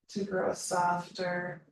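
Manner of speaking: usual speaking voice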